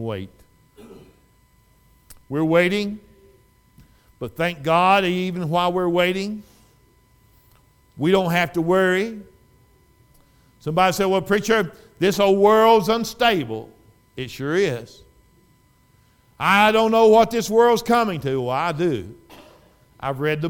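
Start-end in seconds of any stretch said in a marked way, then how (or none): 2.3-13.7 loud, high-pitched preaching; full-force preaching. Real, not acted. Preacher telling the church not to worry, loud preaching. 16.4-18.4 loud, high-pitched mock complaint; worried churchgoer. Churchgoer worrying to the preacher about the world, mock worry.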